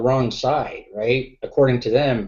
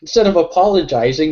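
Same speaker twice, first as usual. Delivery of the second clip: ordinary delivery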